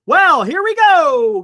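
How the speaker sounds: loud, high, drawn-out lead-in; excited announcement. Real, not acted. Host telling the guys it's starting, excited. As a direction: stretch it out big, like a hype man. Announces the big part to viewers.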